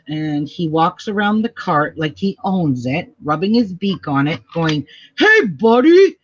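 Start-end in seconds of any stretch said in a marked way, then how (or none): 5.2-6.2 high, loud, cheeky bird greeting; imitating a macaw, pitched up and loud with a rising end; talking parrot voice. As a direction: squawk it out like a pet parrot imitation. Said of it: "That's what he's saying to everybody".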